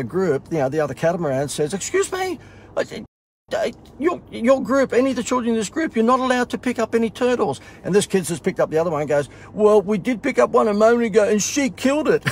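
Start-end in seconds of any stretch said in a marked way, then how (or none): 1.7-7.6 high, prim scolding voice; a stiff young ranger laying down rules; officious guide. Retold or imitated. Other guide scolding group. 9.5-12.2 put-on high, loud child voice; a child tattling bluntly; tattling kid.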